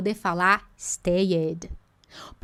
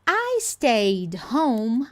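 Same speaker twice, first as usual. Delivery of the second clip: slow structure demo; much slower than usual. Shown as a demonstration. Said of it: "encaro esse I stayed como estrutura".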